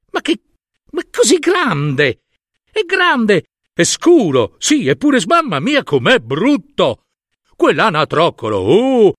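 high-pitched gossiping farmyard crowd, appalled; shocked exclaiming. Acted. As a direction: exclaim in rising disbelief, then reject outright. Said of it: "ed esclamarono".

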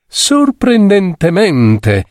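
very high, drawn-out character voice; affected friend. A narrator's reading. A friend commenting on the lady's companion, high affected drawl. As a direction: draw the word right out, pitched high.

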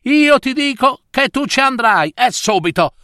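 high, insistent mother snapping; exasperated outburst. Acted. Mother ordering her unwilling daughter to go, exasperated. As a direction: lay down the law to a daughter. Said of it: "sbottò la mamma".

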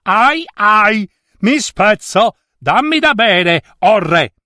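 very high, pained character cry; crying-out rope. A narrator's reading. Rope begs the king again as it frays, crying out. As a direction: plead high and strained, like a fraying rope. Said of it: "la corda incominciò a gridare".

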